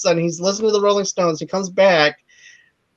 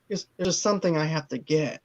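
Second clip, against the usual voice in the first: soft sly quote; 3 semitones lower, 10 dB quieter than usual. Retold or imitated. Imitates Castor's sly smooth line.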